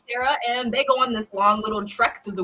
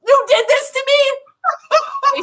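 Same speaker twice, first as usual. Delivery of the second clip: much higher, louder hysterical shout; furious scream; 13 semitones higher, 9 dB louder than usual. Retold or imitated. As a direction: scream at the husband in despair. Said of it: "she's like".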